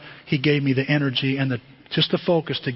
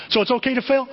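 higher, eager "So it's okay to fail?"; mock hopeful question; 8 semitones higher, faster than usual. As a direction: play the listener looking for a loophole. Mock congregation hoping.

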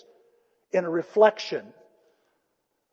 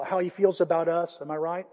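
normal talking voice

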